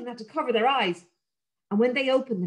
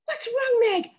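put-on high, anxious little girl; worried girl; 12 semitones higher than usual. Sarah asking her barking dog what's wrong. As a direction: ask the dog gently but worried.

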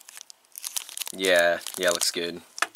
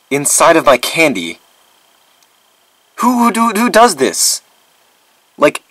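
loud, incredulous outrage; indignant rant; 4 semitones higher, 9 dB louder than usual. Acted. Vlogger who could have died from candy, indignant rant. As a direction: demand loudly who would do such a thing. Ranting about the razor blade.

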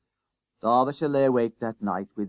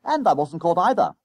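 proud boast; 6 semitones higher, 5 dB louder than usual. A narrator's reading. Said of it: "He glowed with pride".

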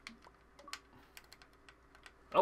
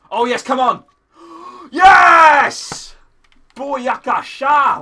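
very loud high victory yell; triumphant shout; 5 semitones higher, 16 dB louder, much slower than usual. Real, not acted. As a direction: roar with triumph. Killing the ghast.

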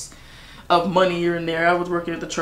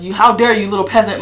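lightly voiced haughty god, louder; a hint of a scornful deity; stoic God voice; 2 semitones higher, 9 dB louder than usual. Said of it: "some stoic God like".

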